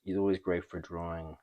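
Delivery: ordinary talk, nothing marked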